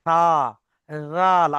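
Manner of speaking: সা and জাল both said with tongue on upper teeth tips; light সা and জাল, tongue touching upper front teeth; tongue-tip demo. Shown as a demonstration. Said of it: "লাগিয়ে উচ্চারণ করবো".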